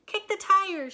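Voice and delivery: high loud shout. A narrator's reading. Moo shouting at the stalled tractor. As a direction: bark the command. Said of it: "shouted Moo".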